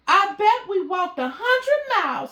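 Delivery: a boastful little kitten moaning; exaggerating kitten.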